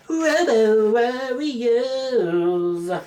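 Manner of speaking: high, very slow drawn-out movie-trailer announcement; dramatic title announcer. Pretend. Vlogger hyping his favourite robot movie, drawn-out announcer call. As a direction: stretch the title like an epic announcer.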